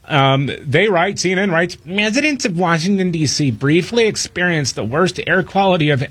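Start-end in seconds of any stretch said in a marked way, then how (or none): 1.8-6.0 higher, nasal-free mock whine; whiny liberal voice. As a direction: read it like a whining liberal. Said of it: "in my whiny liberal voice".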